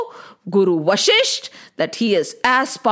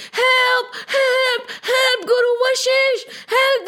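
high, frantic cries for help; shouting for help; 12 semitones higher, slower than usual. Acted. Drowning villagers call out to Guru Vashisht, frantic high cries. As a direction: villagers screaming to their guru in panic. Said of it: "They started shouting".

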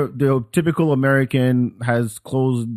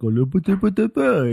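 imitating a Disney French babble, lilting and flowing; a playful French gibberish that flows; mock Disney French; 7 semitones higher than usual. Speaker mocks cartoon French with flowing babble. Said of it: "it flows a lot".

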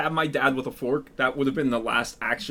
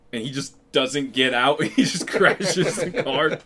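ordinary talk, nothing marked

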